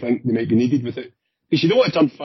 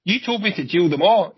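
higher-pitched, defensive comeback; acted protest; 7 semitones higher than usual. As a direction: push the blame back, voice raised in pitch. Cop protests in acted argument.

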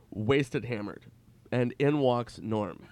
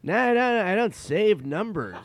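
much higher, louder, slow and stretched greeting; Norm impression; 10 semitones higher, 7 dB louder than usual. Norm greeting his buddy Nicky on the phone, hammed-up. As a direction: gush like an old pal who just remembered.

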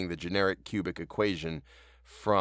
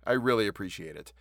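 ordinary delivery, nothing marked

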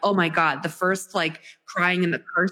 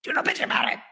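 hissed, firm repeat; stern warning, whispered; 7 semitones higher, much faster than usual. Retold or imitated. Mom warning husband about the road trip, hissed warning. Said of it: "I'm like".

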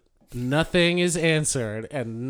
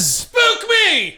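high gleeful shout; 4 dB louder than usual. Pretend. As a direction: yell it out. Shouted spooky intro.

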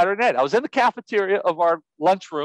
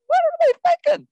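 amazed disbelief. Retold or imitated. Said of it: "I'm like".

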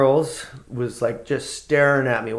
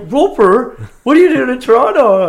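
much higher, louder baffled exclamation; surprised buddy; 15 dB louder than usual. Retold or imitated. Drummer spotting his friend unexpectedly, high surprised. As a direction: imitate a friend's shocked double take.

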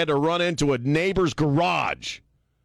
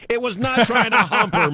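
high, loud indignant denial; outraged contradiction over laughter; loud protest; 12 semitones higher, 5 dB louder than usual. Real, not acted. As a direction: object loudly, pitch shooting up. Loud protest over co-host's joke.

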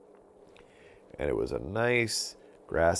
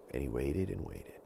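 ordinary delivery

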